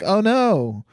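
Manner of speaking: mock concern, tossed off lightly; barely bothered little expression of dismay; casual dismay. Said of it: "it's so casual".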